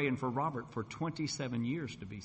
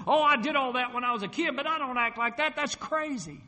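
loud, high scoffing brush-off; scoffing wife; 11 semitones higher, 7 dB louder than usual. Retold or imitated. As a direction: wave it off as crazy, much higher and louder. Wife dismisses his new faith.

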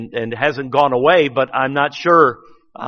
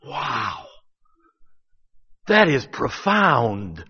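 amazed; 3 semitones lower, 4 dB quieter, much slower than usual. Retold or imitated. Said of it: "looked at someone else and said".

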